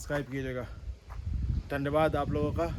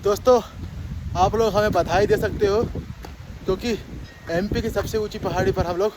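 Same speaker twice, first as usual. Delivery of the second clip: loud, raised voice outdoors; shouting over wind; 5 dB louder than usual. Real, not acted. Shouting on windy hilltop.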